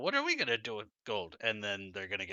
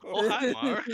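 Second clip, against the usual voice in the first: Tommy Wiseau impression; 10 semitones higher, 5 dB louder than usual. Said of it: "I did not hit her".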